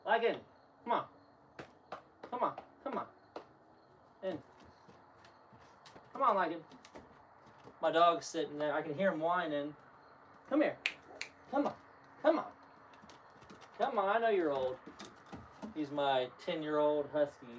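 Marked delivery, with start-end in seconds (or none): 0.1-6.6 high, soft call to a dog. Real, not acted. Owner coaxing his old husky inside, high and soft. 10.5-14.8 high, soft call to a dog. Real, not acted. Owner coaxing his old husky inside, high and soft.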